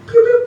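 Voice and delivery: very high pew sounds; laser-like pew noise. Retold or imitated. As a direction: zap it like a toy gun. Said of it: "just going".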